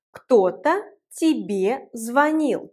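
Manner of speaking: кто-то тебе звонил, spoken slowly and clearly for learners; slow teacher model, word by word; slow, careful teaching demo; slow model sentence. Shown as a demonstration. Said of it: "teacher models a Russian example".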